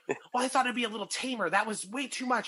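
a hint of a high whiny complaint; lightly voiced upset customer; complaining woman. Woman to security guard, mildly whiny complaint.

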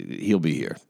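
normal talking voice

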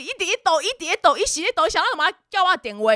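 high, insistent grandma voice, on and on; mock grandma fretting without a pause; nagging grandma. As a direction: keep pestering in a worried old lady's voice. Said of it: "阿嬷就是不停的".

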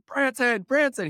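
put-on high, sing-out call for a child. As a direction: imitate a mother calling across the barn. Mother calls out for her son.

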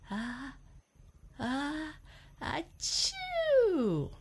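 rising ah ah then a loud high achoo; building sneeze. Acted. Elephant sneezing at his friends, rising then bursting.

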